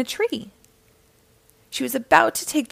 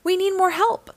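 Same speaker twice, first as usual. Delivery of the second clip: worried cry; 9 semitones higher than usual. A narrator's reading. Dima as the rope pull fails, high worried cry. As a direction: cry out for help, lightly acted. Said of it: "cried Dima".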